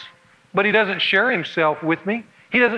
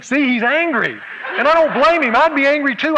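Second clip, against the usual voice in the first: higher and louder, guilty rising distress; distressed Elaine; 7 semitones higher, 4 dB louder than usual. Acted. Elaine sure Roger is angry with her, guiltily upset. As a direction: work yourself up with guilt. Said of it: "And Elaine is thinking".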